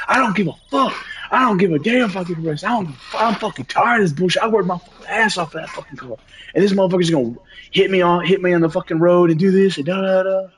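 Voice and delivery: high, strained angry outburst; furious rant. Retold or imitated. Ranting to the cops in a fury over the wreck. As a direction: rage that the car got wrecked.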